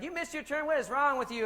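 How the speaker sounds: high, loud exasperated scold; shrill it like a fed-up wife; nagging wife. Retold or imitated. Imitating wife scolding him for missed turn, loud high scold. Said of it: "She's like".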